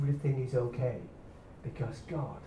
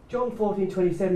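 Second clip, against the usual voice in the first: ordinary talk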